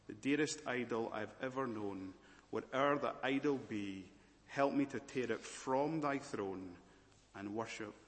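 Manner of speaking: ordinary talk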